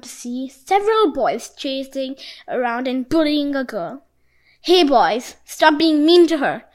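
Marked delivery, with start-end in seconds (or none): 4.6-6.6 loud call to stop the bullies; shout. A narrator's reading. Jinbi shouting at the bullying boys, loud. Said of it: "shouted Jinbi".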